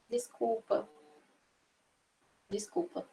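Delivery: soft, sorry desculpa; soft apology. Shown as a demonstration. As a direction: say it quietly, apologetic. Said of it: "Lembra da expressão facial". (desculpa baixinho)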